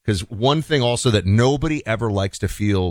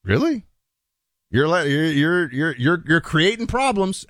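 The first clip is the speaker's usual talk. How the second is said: mock incredulous guys; 6 semitones higher than usual. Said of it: "other guys are gonna go".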